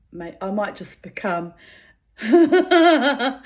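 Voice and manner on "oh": real laughing fit spilling into a word. Real, not acted. Cracking up at her own joke, laughing. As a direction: burst out laughing, high and loud.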